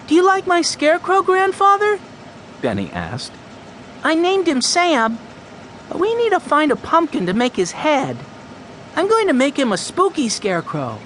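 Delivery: put-on high, eager little boy voice; a six-year-old urgently asking for help; young Benny. Six-year-old Benny worried his scarecrow has no head, high and eager.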